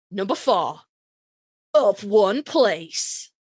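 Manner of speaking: slow, drawn-out chart host read; mock countdown announcer. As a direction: spell it out like a radio chart host.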